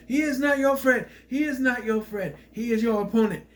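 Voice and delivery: high, loud scolding; imitating yelling wife. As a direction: hammer it into him that he's wrong. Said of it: "She's yelling at him".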